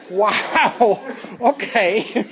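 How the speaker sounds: real laughter running through the words; amazed laughing. Real, not acted. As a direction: react in amused disbelief, laughing. Amused amazement at student's answer.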